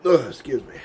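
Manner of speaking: ordinary talk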